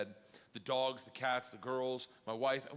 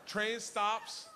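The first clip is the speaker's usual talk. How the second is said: usual speaking voice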